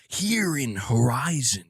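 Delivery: slow, stretched-out trailer narrator impression; Jack Nicholson. Streamer imitating the game trailer's commentator, Nicholson impression. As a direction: draw each word out with a lazy cadence. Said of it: "sounded like Jack Nicholson".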